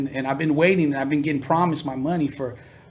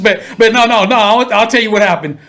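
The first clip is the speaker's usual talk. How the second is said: louder, higher burst of excitement; excited; 7 semitones higher, 9 dB louder than usual. Real, not acted. Excited correction.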